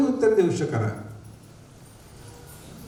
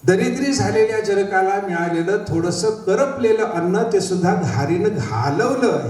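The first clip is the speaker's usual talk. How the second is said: ordinary talk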